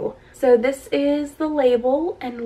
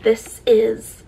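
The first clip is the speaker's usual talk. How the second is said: ordinary delivery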